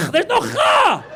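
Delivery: high, loud throat-clearing Kha mockery; mock harsh Kha. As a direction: overdo the guttural sound. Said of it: "you start going".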